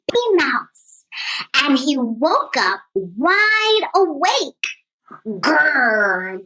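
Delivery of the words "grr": low rumbling growl; lion growl. Acted. Lion growling at the mouse who woke him, low growl. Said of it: "growled the lion".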